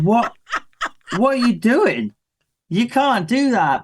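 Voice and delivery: high-pitched baffled dad scolding; comically incredulous telling-off; retold appalled disbelief. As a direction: re-enact a parent aghast at a child, voice shooting up. Dad catches son licking lamppost.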